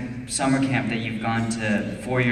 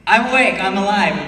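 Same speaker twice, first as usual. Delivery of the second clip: much higher and louder, bursting awake; a sudden burst of louder, higher energy; mock energized; 9 semitones higher, 8 dB louder than usual. Real, not acted. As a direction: jolt yourself awake for the camera. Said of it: "So I'm, like, sorta dead".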